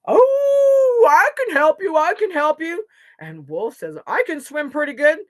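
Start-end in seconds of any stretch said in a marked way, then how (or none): 0.1-2.8 high, loud howl turning into words; howling wolf. A narrator's reading. Wolf offers help to the animals, howling. 4.1-5.2 high, loud wolf voice; eager wolf. A narrator's reading. Wolf tells the animals he can swim, eagerly.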